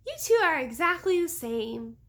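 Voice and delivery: put-on a small girl teasing her sister; little girl voice. A narrator's reading. Little girl answering Sabrina, in a high childlike voice. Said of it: "the little girl replied".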